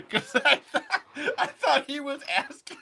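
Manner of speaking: high, loud, words squeezed through laughter; real giggling disbelief at himself; laughing. Real, not acted. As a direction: barely get it out for laughing. Laughing at his own misunderstanding.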